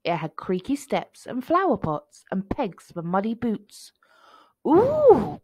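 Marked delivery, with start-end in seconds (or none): 4.7-5.4 high, loud "ooh"; delighted storybook cry; excited cry. A narrator's reading. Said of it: "cried Mouse as he marched".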